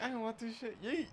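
high mock voice tossing it off; mock mother. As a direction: squeal it like a fed-up mom. Voicing mother throwing baby away.

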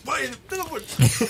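high, hammed-up pleading from the frightened fan; mock begging. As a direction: squeak out a plea for mercy. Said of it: "Eu pulei na frente dele". (imitando o torcedor apavorado, voz aguda)

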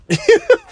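a sharp, high burst of laughter; sudden high laugh. Real, not acted. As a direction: laugh out in disbelief. Shocked laugh at own reaction.

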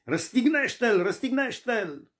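high-pitched chant of the crowd's demand; crowd shouting. Retold or imitated. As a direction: cry it out like the mob demanding crucifixion. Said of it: "Pentru că strigau tare". (strigătul mulțimii, pe ton înalt)

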